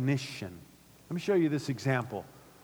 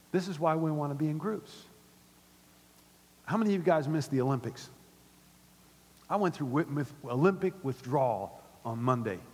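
normal talking voice

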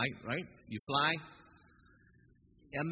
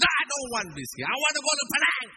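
high, loud, fast mock tantrum; hammed-up passenger tantrum; angry refusal; 13 semitones higher, 8 dB louder, faster than usual. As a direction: insist on Penang, much higher and louder.

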